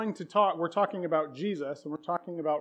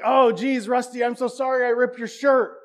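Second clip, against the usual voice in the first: louder, higher, rushed "oh geez" apology; hammed-up flustered apology; 5 semitones higher, 7 dB louder than usual. Eighth-grade [name] apologizing to Rusty, flustered and loud. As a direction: blurt the sorry out like a nervous kid.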